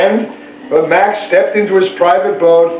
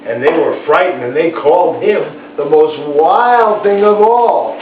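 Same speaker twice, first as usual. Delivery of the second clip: plain reading voice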